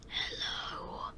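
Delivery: breathy creepy whispered hello. Acted. Mechanic greeting the new guard in a creepy whisper. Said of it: "Why did you do that voice?".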